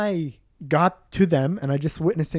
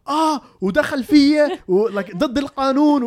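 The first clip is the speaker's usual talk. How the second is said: put-on higher, aggrieved Palestinian-accented complaint; angry victim act; 7 semitones higher, 4 dB louder, faster than usual. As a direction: imitate an angry man playing victim to police. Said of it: "in an angry way, like he's the victim".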